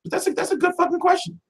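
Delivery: high, impressed by the question; a startled good question; surprised. Real, not acted. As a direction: admit it stumps you.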